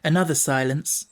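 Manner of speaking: ordinary delivery, nothing marked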